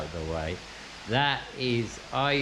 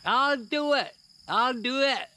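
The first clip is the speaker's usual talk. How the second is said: put-on high, loud eager shout in a Scottish accent; calling out from the back; 12 semitones higher, 3 dB louder than usual. Little man volunteering from the crowd, calling out in Scottish. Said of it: "this voice from the back".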